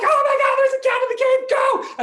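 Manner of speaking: high, loud panicked mouse squeal; freaking out. Acted. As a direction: shriek in hammed-up panic. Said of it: "the mice freak out".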